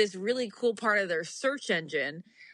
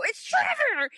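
excited; slower than usual. Retold or imitated. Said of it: "and I go".

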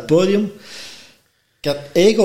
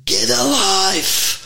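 high, drawn-out "Get alive!" with every word stretched; shouted command; 7 semitones higher, much slower than usual. Retold or imitated. Trainer on stage yelling at him, slow high shout. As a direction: bellow it slowly at someone on stage. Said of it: "die roept keihard".

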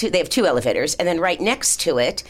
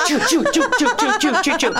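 high, rhythmic "choo" noises; high-pitched mechanical chugging through laughter; imitated clanging escalator noise; 10 semitones higher, faster than usual. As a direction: imitate a noisy machine chewing away. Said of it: "That thing made the noise".

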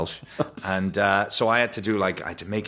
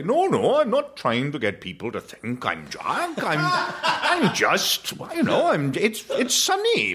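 dad's voice; 10 semitones higher, slower than usual. Retold or imitated. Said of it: "and he'd say".

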